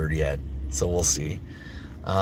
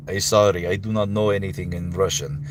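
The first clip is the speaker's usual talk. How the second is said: halting-grammar Russian accent; put-on Russian accent. Shown as a demonstration. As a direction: apologise in a thick Russian accent. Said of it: "Russian accent".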